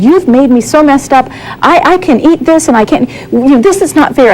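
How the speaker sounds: railing at God in mild mock upset; retold anger at God. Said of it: "I really was mad at God".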